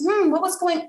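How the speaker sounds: high, musing parent voice; gentle curiosity. Acted. Parent guesses at what happened, gently curious. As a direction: open with a pondering hum.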